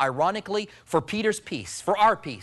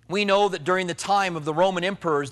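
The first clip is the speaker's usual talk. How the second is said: ordinary talk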